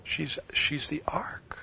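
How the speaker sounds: slow whispered revelation; a hushed realization. Real, not acted. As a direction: whisper the secret slowly.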